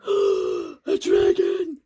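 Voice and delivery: slow, loud, drawn-out scream; terrified cry. Acted. Frog hearing footsteps, crying out in terror. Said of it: "cried Frog".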